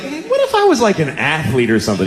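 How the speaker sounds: very high, tentative patient voice; hopeful plea. Retold or imitated. Patient trying his luck with doctor, hopeful high plea. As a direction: float the idea in a squeaky high pitch.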